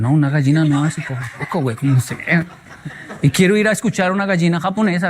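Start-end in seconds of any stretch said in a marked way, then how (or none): 0.0-2.4 laughing through a mock protest; scoff, laughing, at the idea; laughing disbelief.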